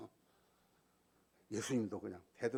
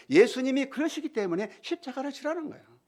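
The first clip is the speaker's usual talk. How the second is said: high, loud stress on 지라는; 지라는 driven home high; preaching emphasis; 10 dB louder than usual. Real, not acted. Preaching climax. (지라는을 높고 힘있게)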